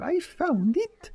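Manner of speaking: put-on high, slow French-accented squeak. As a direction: imitate a cute French cartoon girl. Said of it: "in her little French accent".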